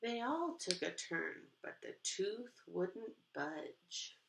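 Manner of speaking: put-on lively read-aloud to kids; expressive picture book narration; storybook reading. A narrator's reading. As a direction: read it like storytime for little ones.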